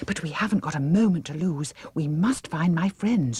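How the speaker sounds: quick urgent push; pressing urgency. Acted. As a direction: hurry them along. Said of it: "she said urgently".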